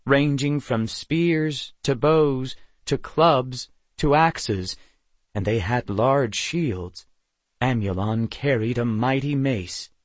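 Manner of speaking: usual speaking voice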